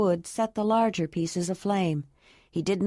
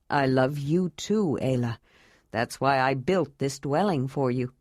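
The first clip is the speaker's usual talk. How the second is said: lower, earnest man's voice; 6 semitones lower than usual. A narrator's reading. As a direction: speak as the tall, earnest man. Jondalar voiced by narrator.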